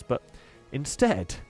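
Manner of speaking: plain reading voice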